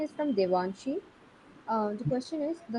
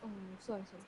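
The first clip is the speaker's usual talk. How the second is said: very faint, distant voice; barely audible; 4 semitones lower, 15 dB quieter, much faster than usual. Real, not acted. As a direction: speak barely above nothing. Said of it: "but your voice is very low".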